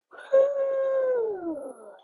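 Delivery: eerie high ghostly hoot; icy call. Acted. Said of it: "an icy voice called".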